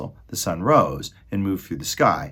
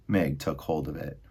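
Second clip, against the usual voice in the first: usual speaking voice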